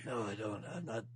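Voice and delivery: low, soft tough-guy voice for his own talk; keeps the Snake voice. Pretend. Host joking he'll do the podcast as Snake, low and soft. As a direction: stay low and quiet in character. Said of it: "easier to talk like this".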